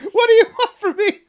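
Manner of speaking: high, loud, fast mock protest; exasperated retort. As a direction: throw it out as a hammed-up exasperated yell. Jokingly acts an exasperated retort.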